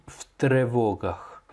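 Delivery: в тревогах slowly, with a clear "tre"; imitated mispronunciation. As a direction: keep an e in the first syllable of в тревогах. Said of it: "tu as dit". (в тревогах prononcé « tré », comme l'élève)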